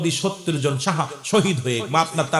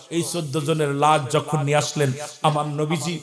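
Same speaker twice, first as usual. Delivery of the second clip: ordinary delivery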